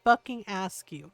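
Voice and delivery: loud, forceful challenge; emphatic, exasperated demand; indignant. Retold or imitated. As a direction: punch it out in outrage. Repeats challenge to mean commenters.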